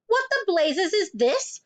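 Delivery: high, slow outraged demand; yelled. A narrator's reading. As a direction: bellow in shocked indignation. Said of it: "yelled the Trunchbull".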